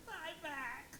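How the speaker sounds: goofy, drifting drugged hellos; loopy drugged greeting. Retold or imitated. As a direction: say it sedated and silly.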